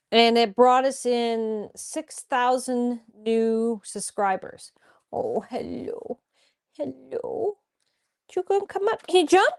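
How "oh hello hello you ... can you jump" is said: soft coaxing to pet. Real, not acted. Greeting the dog softly.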